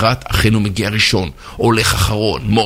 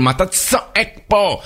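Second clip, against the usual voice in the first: retold annoyance; 8 semitones higher than usual. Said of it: "המזכירה מתעצבנת עליו".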